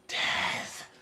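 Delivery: breathy whispered word; mock whispered death. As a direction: breathe it out like a dying gasp. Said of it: "the Spanish equivalent of".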